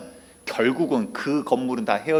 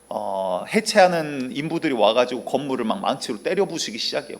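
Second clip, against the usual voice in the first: normal talking voice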